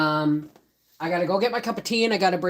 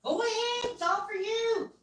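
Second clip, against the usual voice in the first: pet talk; 9 semitones higher, 7 dB quieter, faster than usual. Real, not acted. Feeding the pet chicken.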